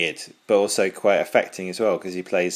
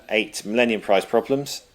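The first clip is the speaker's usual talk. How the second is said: ordinary delivery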